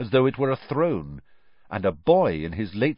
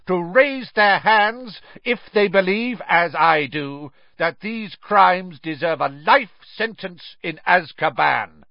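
raised, high judge's voice; shouted demand; 8 semitones higher, 4 dB louder than usual. A narrator's reading. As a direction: demand the verdict from the whole court. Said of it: "shouted Mister Crouch".

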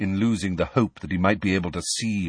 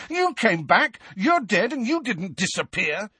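angry; 12 semitones higher than usual. Acted. Harry angry continuing.

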